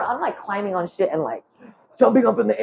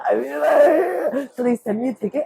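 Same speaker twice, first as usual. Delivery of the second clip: normal talking voice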